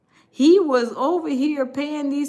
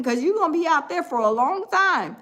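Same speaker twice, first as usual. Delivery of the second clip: ordinary delivery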